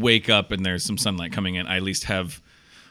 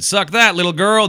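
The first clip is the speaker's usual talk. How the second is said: higher, louder gloating taunt; mocking taunt; 9 semitones higher, 5 dB louder than usual. Co-host jokingly plays the friend gloating at the girl, high loud taunt. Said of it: "and you said".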